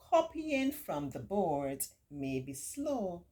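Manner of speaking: ordinary delivery, nothing marked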